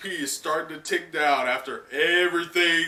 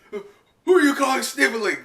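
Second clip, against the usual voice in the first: put-on much higher, louder kid's protest; indignant Momo; 11 semitones higher, 7 dB louder than usual. Momo offended by Luffy's insult, high indignant yelp. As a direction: squeal back offended. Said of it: "Momo goes".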